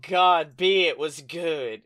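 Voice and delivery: ordinary talk, nothing marked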